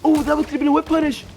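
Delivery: high, fast excited commentary. Real, not acted. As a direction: leap up in hype at the punish.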